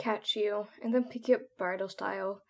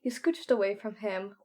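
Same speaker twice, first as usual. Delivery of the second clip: plain reading voice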